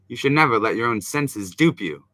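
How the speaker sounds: ordinary talk, nothing marked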